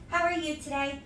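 usual speaking voice

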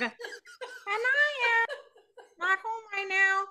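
very high cartoon frog greeting; high-pitched frog impression; Kermit the Frog. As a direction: play a squeaky puppet frog on voicemail. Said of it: "all in a frog voice".

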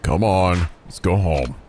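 deep, gruff guardian tone; Kiryu voice. Acted. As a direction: call her home like a firm uncle.

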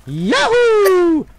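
Mario yahoo. Acted. Mario catchphrase screamed.

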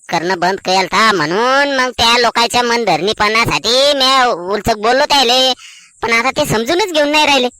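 slow, stretched-out self-justification; plaintive explaining. Acted. Man explains he spoke to appease workers, plaintively. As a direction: drag the words out in protest. Explaining himself after the quarrel.

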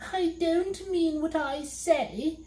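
a high weepy little demon; crying. A narrator's reading. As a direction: wail it out and flee. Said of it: "crying hysterically".

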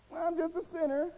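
high-pitched mock self-pity; mocked excuse. Mocking a believer's habitual excuse.

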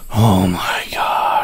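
breathless whisper of disbelief; a real stunned whisper at the screen; whispered oh my god. Real, not acted. Exasperated at the game.

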